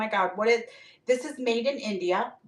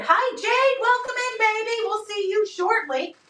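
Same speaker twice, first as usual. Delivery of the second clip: bright singsong welcome, pitched way up; high-pitched greeting; 9 semitones higher than usual. Real, not acted. Seller welcoming Jade to live sale, high-pitched greeting. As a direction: greet in a high, chirpy lilt.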